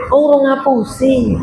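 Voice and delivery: an exaggerated "Oh, orang ngapusi." as if suddenly remembering; mock realization. Shown as a demonstration. Preacher mocking a liar who misses lying, with mock surprise. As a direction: play "orang ngapusi" as the thing missing from life, a sudden aha. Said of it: "Apa ya?".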